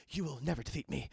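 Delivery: exhausted defiance. Retold or imitated. Jack answers the tired Scotsman, exhausted but defiant. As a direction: imitate a spent hero refusing to yield. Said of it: "they're just so fucking tired".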